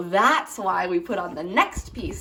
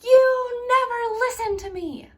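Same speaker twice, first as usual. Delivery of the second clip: high, whiny accusing voice; petulant accusing tone, pitched high; blaming whine; 13 semitones higher, 3 dB louder, faster than usual. Shown as a demonstration. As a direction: whine it at them like a hurt kid. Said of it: "instead of saying".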